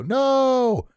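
put-on high no like a chorus of kids; a child's high, emphatic no; kids answering no. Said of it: "And the kids go".

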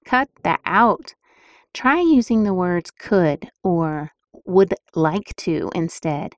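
ordinary talk

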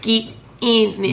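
high, slow Aussie captain voice; Australian accent. Retold or imitated. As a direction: nail the Aussie captain line. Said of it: "Nailed it".

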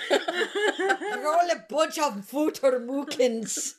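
slow, laughing mock German accent. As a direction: draw out a fake German insult, cracking up.

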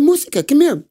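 high, bright call to a pet; calling the mouse. Acted. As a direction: call the little mouse over. Ganesha calls his mouse.

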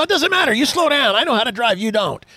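high-pitched mock scolding, easing down at the end; acted bickering comeback. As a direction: play the insistent husband laying down the law. Husband's comeback in retold quarrel.

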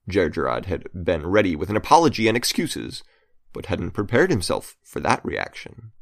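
ordinary delivery

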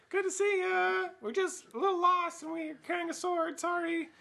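high, put-on sheepish voice; sheepish apology. Intruders caught carrying a sword greet the curator, sheepishly. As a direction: excuse yourself in a mock-innocent high pitch.

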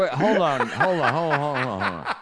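high, laughing "hold on"; laughing interruption. Real, not acted. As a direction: stop them through laughter.

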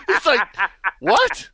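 laughing through the quote; can't hold back the laughter while quoting. Real, not acted. The father laughs while retelling his son's reply.

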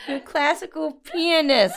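laughing it out, loud and high; slow, belly-laughing emphasis; cracking up. Real, not acted. Host repeating the word that set her off, cracking up.

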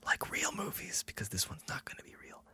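whispered aside; hushed conspiratorial aside. Acted. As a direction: drop to a secret whisper. Joking aside in letter.

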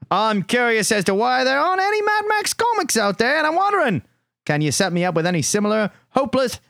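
high, hammed-up Aussie voice; Australian accent. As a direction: read it as an excitable mock Australian. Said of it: "that is my Australian accent".

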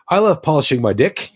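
high, fast hammed-up Kiwi voice; mock Kiwi accent. Mocking a Kiwi commercial, in a high hammed-up Kiwi accent. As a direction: ham up a Kiwi deck polish ad. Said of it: "that's a very Kiwi way of saying it".

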